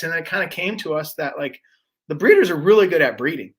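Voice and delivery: usual speaking voice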